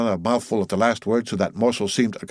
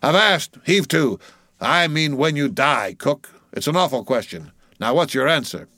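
higher-pitched seaman's halt, easing into questioning; commanding mate; 6 semitones higher, 3 dB louder than usual. Acted. Mate asking cook about dying, commanding. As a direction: call him up short, then press him.

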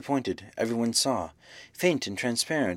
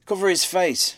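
higher-pitched urgent order from a bystander; urgent plea; 7 semitones higher, 4 dB louder than usual. A narrator's reading. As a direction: cry out for it to be done. Man urgently demands face be covered.

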